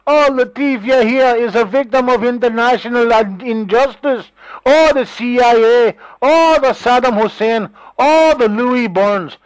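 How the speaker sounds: a hint of a sulky boy complaining; mocking whiny victim. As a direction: parody a boy crying wolf, slow and high. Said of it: "right, that goes".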